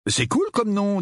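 high, lightly mocking tone; teasing with a smile. A narrator's reading. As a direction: needle your cousin through a smile. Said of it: "dit Harry avec un sourire". (taquinerie souriante, voix haut placée)

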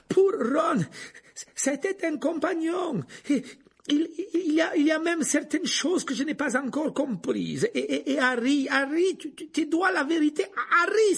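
high, loud, stammering desperation; panting, pleading. A narrator's reading. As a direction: stumble through the plea mid-struggle.

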